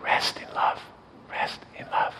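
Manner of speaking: unvoiced, tender whisper; dying husband whispering to his anxious wife. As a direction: breathe it softly to his wife. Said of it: "he whispered to her".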